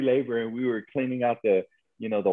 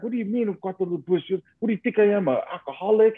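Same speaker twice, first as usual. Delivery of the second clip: imitating an indignant South El Paso customer, higher pitch; South El Paso accent, pissed off; 7 semitones higher, faster than usual. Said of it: "the South El Paso accent and everything".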